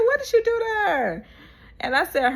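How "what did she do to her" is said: imitating her, pitched way up; high-pitched mocking retelling; high mock squeal. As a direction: squeak the question out, mock shocked. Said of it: "She said".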